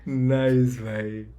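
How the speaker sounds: loud, drawn-out approval; impressed praise. Real, not acted. Fan praising a clever rap bar, impressed. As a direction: stretch it out loudly, really impressed.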